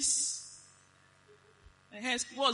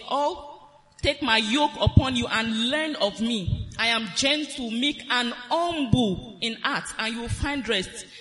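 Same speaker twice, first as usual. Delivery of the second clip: scripture reading; 5 dB louder than usual. Acted. Preacher reading Matthew eleven to the church, solemn proclamation. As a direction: read out louder and slower as holy words. Reads Jesus' words from scripture.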